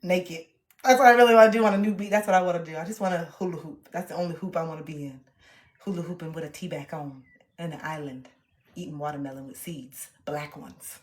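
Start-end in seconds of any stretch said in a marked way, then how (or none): 2.8-11.0 much softer, dreamy low musing; dreamy hush. Real, not acted. Daydreaming of a beach, soft dreamy murmur. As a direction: drift off into the daydream.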